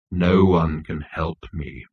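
slow, low, gloomy intoning. Acted. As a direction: intone it in deep despair. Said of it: "intoned Marvin".

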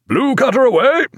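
high, carrying command; shouted order. A narrator's reading. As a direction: call out the order to the boat crew. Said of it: "he gave the order".

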